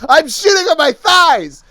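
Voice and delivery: loud high panicked yell. Retold or imitated. Yelling to the colonic lady in the next room, panicked. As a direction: shout it across the room to her. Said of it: "I just yelled out to the lady".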